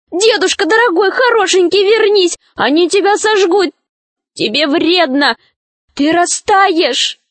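put-on high, urgent girlish pleading; alarmed pleading cry. A narrator's reading. Snegurochka begs Grandfather Frost to return, high anxious pleading. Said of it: "закричала Снегурочка".

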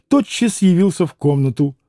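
ordinary delivery, nothing marked

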